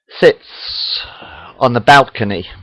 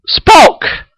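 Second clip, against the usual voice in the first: very high gasped name; astonished gasp. A narrator's reading. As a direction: gasp it out in disbelief. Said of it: "gasps Admiral Kirk".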